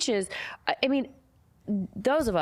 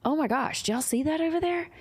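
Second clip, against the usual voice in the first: retold surprise; 10 semitones higher than usual. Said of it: "and I was like".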